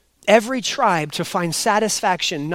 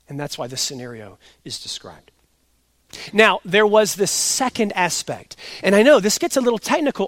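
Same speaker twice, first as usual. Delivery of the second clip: ordinary talk, nothing marked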